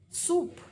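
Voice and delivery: "Soup" with lips rounded already on the S; rounded S demo. Shown as a demonstration. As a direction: round the S in "Soup" before the vowel. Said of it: "S slightly rounded".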